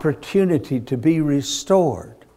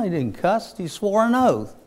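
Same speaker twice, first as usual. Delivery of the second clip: ordinary talk, nothing marked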